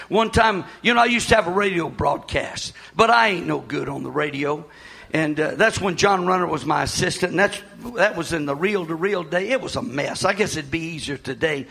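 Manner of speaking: ordinary talk, nothing marked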